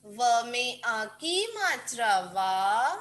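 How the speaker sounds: put-on slow, drawn-out dictation chant for kids. Shown as a demonstration. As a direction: spell each syllable out slowly for children to write. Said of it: "बोल बोल के लिखेंगे".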